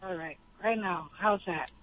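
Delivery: speaking right into the handset; phone held close. Real, not acted.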